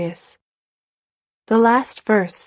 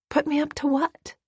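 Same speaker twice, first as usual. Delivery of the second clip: put-on high, puzzled child's question; genuinely lost, asking back; a girl baffled by the accusation; honest bewilderment; 8 semitones higher than usual. A narrator's reading. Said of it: "in honest bewilderment".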